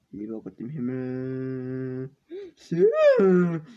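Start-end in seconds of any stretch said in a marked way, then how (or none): 2.6-3.7 high loud delighted cry; excited cheer. Real, not acted. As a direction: celebrate the pull. Cheering a pack pull. (begeisterter Ausruf)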